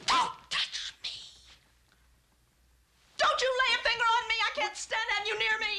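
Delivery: wife refuses his touch, rising anger; furious recoil. Acted. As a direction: pull away and snap at him.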